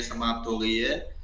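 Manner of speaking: ordinary talk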